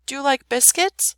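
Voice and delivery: mildly firm princess; firmer voice. A narrator's reading. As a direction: ask with steadier nerve. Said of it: "The princess's voice grew firmer".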